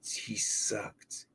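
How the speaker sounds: under-the-breath whispered put-down; whispered aside. Real, not acted. Player rated to stream chat, whispered aside. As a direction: breathe it out as a throwaway dig.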